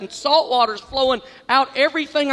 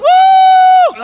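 very high, loud whoo; a wild gleeful woo; whoop of joy; 13 dB louder than usual. Retold or imitated. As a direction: yell out with glee as the wave hits. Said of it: "I'm going".

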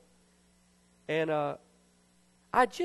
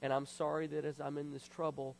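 soft, lower, confiding prayer voice; hushed repentant prayer; 3 semitones lower, 6 dB quieter than usual. Retold or imitated. Man re-enacting his apology to God, quietly contrite. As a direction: confess it gently to God, pausing between thoughts.

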